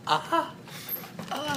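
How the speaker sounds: short high "ah" moans; pained gasps. Acted. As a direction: whimper as an injured guard. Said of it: "And he's just like".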